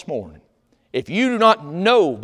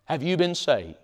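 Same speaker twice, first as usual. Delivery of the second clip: ordinary talk, nothing marked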